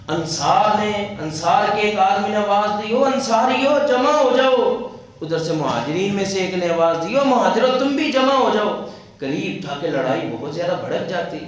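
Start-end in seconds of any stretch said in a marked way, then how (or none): 2.9-4.9 raised, higher rallying call; a man shouting for his clan to rally. Retold or imitated. Man calls his tribe together. 7.2-8.9 raised, higher rallying call; a man shouting for his clan to rally. Retold or imitated. Rival man calls his side.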